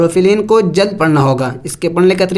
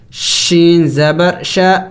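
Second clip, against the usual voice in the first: ordinary talk, nothing marked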